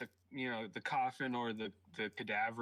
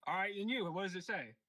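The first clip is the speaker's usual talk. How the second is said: in-character demand; 7 semitones higher than usual. Acted. Player demands goblin boss translate.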